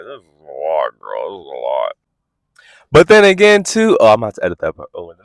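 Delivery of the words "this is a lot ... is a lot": low deadpan. Retold or imitated. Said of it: "I'm like".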